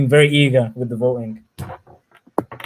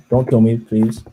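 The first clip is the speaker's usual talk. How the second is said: ordinary delivery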